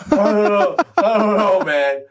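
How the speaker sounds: high, giggling shrug; laughing helplessly. Retold or imitated. Lou's sheepish answer, laughing.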